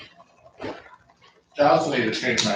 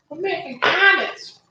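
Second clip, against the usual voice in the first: much higher cartoon voice; high silly voice. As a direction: squeak it high.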